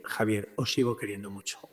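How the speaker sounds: very soft, low, intimate voice; tender reassurance. Retold or imitated. The Lord answering Javier from the tabernacle, tender. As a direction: drop to a gentle, loving murmur.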